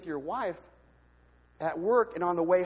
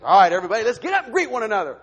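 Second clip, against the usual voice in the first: imitating the pastor's loud call to the congregation; preacher calling the room; 3 semitones higher, 12 dB louder, much faster than usual. Pastor calling the congregation to stand, loud. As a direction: boom it out like a pastor rallying the room. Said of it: "and he says".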